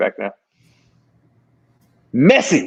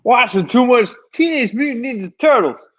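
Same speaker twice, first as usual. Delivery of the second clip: mocking echo; 10 semitones higher than usual. Mocking friend's line back.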